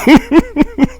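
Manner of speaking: high burst of laughter; loud laugh. Real, not acted. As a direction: cackle out loud. Laughing at robot story.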